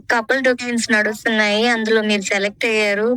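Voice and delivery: ordinary delivery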